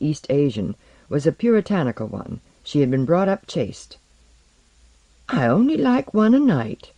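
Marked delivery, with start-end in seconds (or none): 5.3-6.9 high, lilting, innocent reply; innocent serenity. A narrator's reading. Olaru replying to her cabinmate, innocently serene. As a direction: answer lightly and guilelessly, pitched up. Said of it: "replied with innocent serenity".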